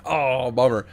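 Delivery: ordinary talk, nothing marked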